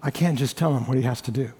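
ordinary talk

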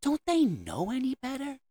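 high, soft mock-parent question. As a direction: ask it like a baffled parent. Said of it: "the question of".

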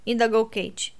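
plain reading voice

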